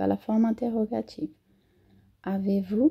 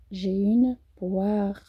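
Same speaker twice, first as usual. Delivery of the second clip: slow demo; much slower than usual. Shown as a demonstration. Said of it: "professora demonstra pronúncia devagar".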